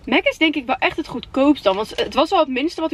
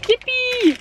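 excited cheer; 11 semitones higher than usual. Real, not acted. Cheering at toy.

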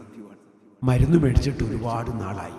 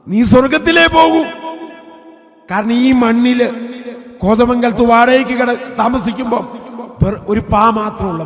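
high, loud preacher's cry; preacher reliving his words to his dying wife, crying out; fervent preaching; 10 semitones higher, 9 dB louder than usual. Acted. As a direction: proclaim it over the music. Preaching at full force over music.

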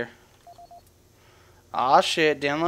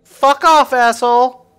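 high, loud, angry yell; yelled in real anger; angry shout; 7 semitones higher, 10 dB louder than usual. Real, not acted. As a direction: snap back loud at the sergeant. Yelling at NPC.

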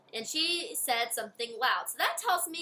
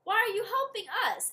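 surprised question; 8 semitones higher than usual. Acted. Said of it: "Eric asked the witch".

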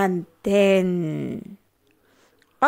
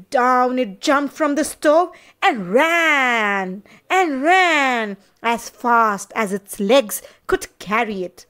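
usual speaking voice

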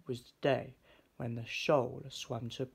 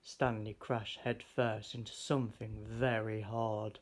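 usual speaking voice

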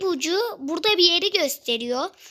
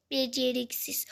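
ordinary talk

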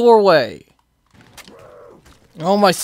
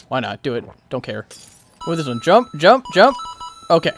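usual speaking voice

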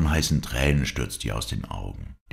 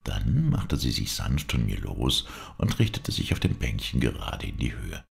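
plain reading voice

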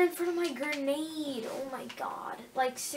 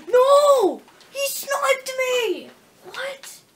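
much higher, louder, slow outraged cry; outraged yell; 11 semitones higher, 10 dB louder, much slower than usual. Real, not acted. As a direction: yell at being sniped. Sniped in game.

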